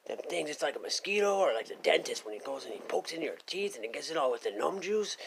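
ordinary delivery

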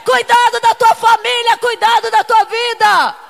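loud, fervent preaching cry; full-force preaching. Acted. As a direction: preach it to the congregation with fire.

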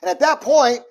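normal talking voice

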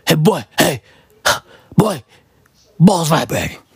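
low, mock manly voice; comically deepened tough-guy voice. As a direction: drop low as if the voice has changed. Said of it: "Even her fucking voice would change".

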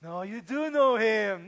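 slow, pointed insistence; girl insisting to Peter; insistent girl. Acted. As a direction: lean on every word.

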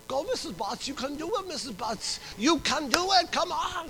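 high-pitched mock cheering; cheerleader. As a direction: chant encouragement like an excited cheerleader. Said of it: "like a cheerleader".

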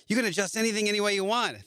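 quick, high-pitched sales boast; mock enthusiasm. Upbeat pitch before the bad news.

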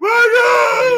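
high screaming fan yell. Retold or imitated. A fan at his concert, screaming high and loud.

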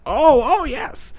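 high mock old-man realization; high-pitched, delayed recognition; hard-of-hearing old man. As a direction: imitate an old man finally catching on. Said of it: "I'm guessing mid to late eighties".

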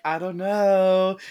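clueless. Retold or imitated. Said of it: "Stevie's like".

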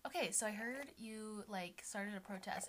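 soft, slow imitation; mellow. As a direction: mimic him easing quietly into an accusation. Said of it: "the most mellow, like, sentence".

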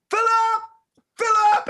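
high, loud holler for a friend; calling out. Retold or imitated. Waving across four lanes of traffic, calling out. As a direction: re-enact yelling a name across a wide street.

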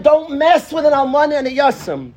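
fiery preacher reading; declaimed verse. Shown as a demonstration. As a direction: thunder the warning. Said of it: "One more time".

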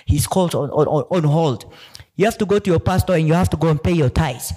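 imitating a Nigerian pastor-like Siri; mock Nigerian accent. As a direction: preach about tithes in a mock Nigerian accent. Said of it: "Siri will be like".

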